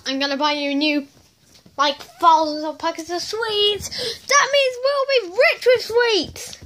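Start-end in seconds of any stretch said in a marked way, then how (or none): 4.3-6.6 high-pitched excited squeal; overjoyed high toy-character voice; gleeful excitement. Acted. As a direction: cry it out in high delight. Characters hear of thousands of sweets.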